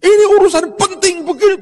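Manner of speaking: high, loud, furious yelling; angry shouting. Retold or imitated. Rich man yelling at ticket clerk, loud and high. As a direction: yell at the counter in a rage. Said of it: "dia teriak-teriak".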